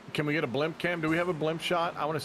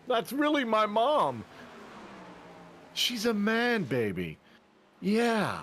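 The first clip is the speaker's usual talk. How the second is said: movie character impression; 10 semitones higher, slower than usual. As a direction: ham up the outrageous line for laughs. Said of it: "My favorite was when he beat up his mom".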